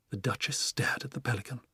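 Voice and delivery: ordinary delivery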